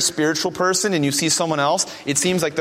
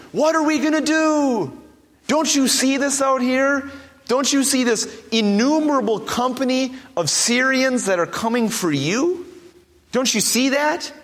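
high, frantic pleading voice; a terrified young man begging his master; panicked servant; 7 semitones higher than usual. Retold or imitated. Servant to Elisha at the wall, panicked and high. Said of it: "You might say that".

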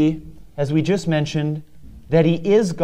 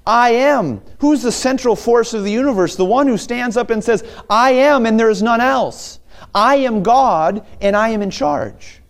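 higher, proclaiming I am with rising fervour; preaching at full force; 7 semitones higher than usual. Acted. Preacher proclaiming God's nature to the congregation, fervent. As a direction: thunder it out like a revival preacher.

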